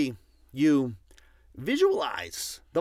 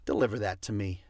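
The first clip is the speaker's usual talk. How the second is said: lower, quick and firm; crisp low command; 6 semitones lower, 3 dB quieter, faster than usual. Shown as a demonstration. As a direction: give the order, no asking. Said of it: "you say to your little energetic assistant".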